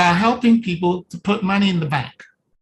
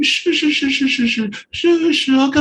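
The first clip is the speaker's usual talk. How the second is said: a lightly voiced adult going shoo; mock shushing adult; 6 semitones higher than usual. Coach imitating an adult shushing a crying baby. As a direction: hush the baby, high and repeated. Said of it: "the first response of the adult is shoo".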